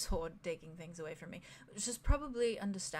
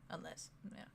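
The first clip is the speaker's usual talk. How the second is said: whispering; 3 semitones lower, 14 dB quieter than usual. Acted. Quiet menacing aside.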